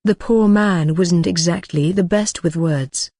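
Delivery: usual speaking voice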